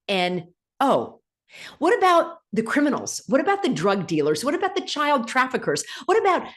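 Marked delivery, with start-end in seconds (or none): 0.1-1.2 "N O" spelled letter by letter; each letter of "N O" said on its own. Shown as a demonstration. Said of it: "how do I spell it?".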